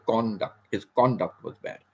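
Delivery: CON-duct, full "o" in "conduct"; first-syllable stress. Shown as a demonstration. Teacher shows the noun, "conduct" stressed on CON. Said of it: "we stress on the first syllable".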